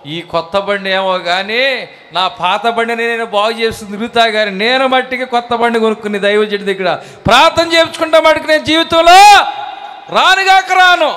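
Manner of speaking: starts plain, climbs to a high loud shout; believer's vow building to a shout. Retold or imitated. Believer swearing never to visit the pastor again, building to a shout. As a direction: swear it off, rising to a yell. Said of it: "ఒకడు అన్నాడు అంట".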